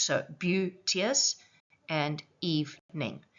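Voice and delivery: "beauteous" and "evening" drawn out slowly, each syllable clear; slightly exaggerated pronunciation. Shown as a demonstration. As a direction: stretch every syllable of "beauteous" and "evening" like a teacher. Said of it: "when I say the words in a slightly exaggerated way".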